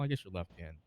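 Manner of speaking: solemn judge reading an oath; formal oath. Acted. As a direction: swear them in like a stern court judge.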